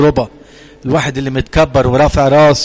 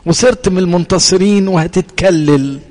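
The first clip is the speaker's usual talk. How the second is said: ordinary talk, nothing marked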